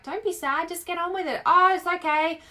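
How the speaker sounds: mock chorus of people telling her to cheer up; mocking dismissive friends. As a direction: brush off the sadness like everyone does, building to a loud Aussie "she'll be right, mate". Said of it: "And everyone's like".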